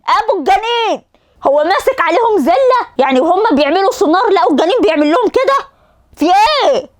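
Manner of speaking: high exasperated complaint; ranting mockery of the fetus; indignant outburst. Real, not acted. (استياء ساخر بصوت عالٍ)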